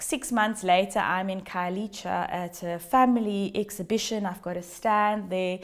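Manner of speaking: ordinary talk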